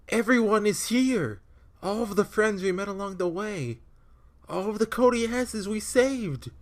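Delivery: high, earnest heroic speech; dramatic hero voice. Acted. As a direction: deliver it like a desperate anime hero.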